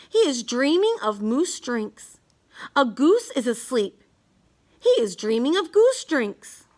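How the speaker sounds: plain reading voice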